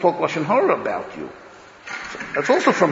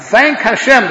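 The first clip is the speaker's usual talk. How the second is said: loud, slow and high, driving home thank Hashem; emphatic call; 9 semitones higher, 9 dB louder, slower than usual. Acted. Preacher urging his listeners, loud and slow. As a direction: lean hard on the exhortation.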